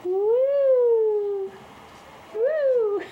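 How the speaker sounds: loud high whoops; cheering. Real, not acted. Watching the kittens run off, loud high cheering. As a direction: whoop with delight.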